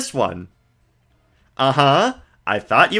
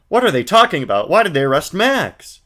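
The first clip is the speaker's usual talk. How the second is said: put-on higher, brighter girl voice; Maya voice; 6 semitones higher, 4 dB louder than usual. Streamer voicing Maya to the viewers, girlish and high. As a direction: play an eager young girl.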